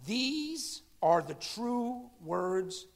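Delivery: a hint of an angel, slow and hushed; solemn angel voice. Angel speaking to John, lightly voiced and very slow. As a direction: lay each word down slowly. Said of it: "And he added".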